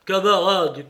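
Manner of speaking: loud call to the men; crying out. A narrator's reading. Silver hailing his frightened comrades, loud call. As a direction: call out with effort, voice lifted. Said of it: "cria-t-il".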